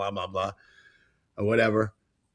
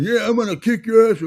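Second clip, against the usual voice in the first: high, loud tough-guy taunt; imitating a loudmouth yelling a threat; mock yell; 10 semitones higher, 5 dB louder than usual. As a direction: bark it like a street bully.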